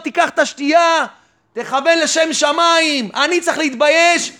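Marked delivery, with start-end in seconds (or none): none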